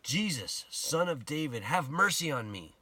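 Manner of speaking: shouted plea, voiced by a storyteller; retold shout. Blind Bartimaeus calls out to Jesus for mercy, retold shout. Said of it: "And he starts to shout".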